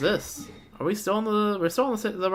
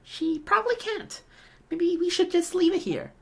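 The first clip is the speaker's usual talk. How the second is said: put-on high girl voice; 12 semitones higher than usual. Thief girl explaining herself to the travellers in a high voice. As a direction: play the young woman pleading her case.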